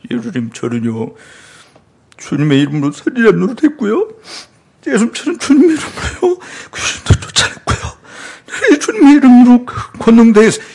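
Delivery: mild mock-tearful confession; teary pleading. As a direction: plead to Jesus close to tears. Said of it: "아까처럼 그냥 눈물을 질짜면서".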